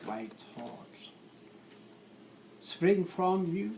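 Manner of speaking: measured love-sonnet reading; poetry recitation. Acted. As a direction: recite in short phrases with long pauses.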